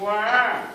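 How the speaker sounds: put-on high loud baby wail; baby cry. Shown as a demonstration. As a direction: wail like a newborn. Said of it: "Now, do it".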